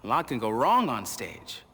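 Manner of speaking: usual speaking voice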